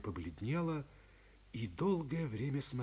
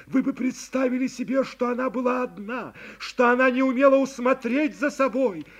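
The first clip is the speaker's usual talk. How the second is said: louder, higher impassioned plea; pleading young woman; 10 semitones higher, 15 dB louder than usual. A narrator's reading. Girl pleading with her friend, impassioned and rising. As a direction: beg him to see she is blameless.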